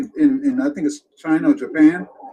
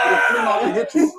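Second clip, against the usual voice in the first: much higher, half-shouted repeat; disgusted outcry; 9 semitones higher, 4 dB louder than usual. Real, not acted. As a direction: yell it out in disgust. Grossed-out retelling of spit.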